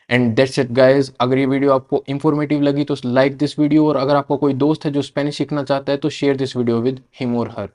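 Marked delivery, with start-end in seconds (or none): none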